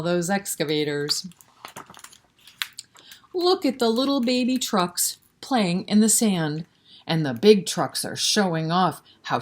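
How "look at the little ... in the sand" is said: plain reading voice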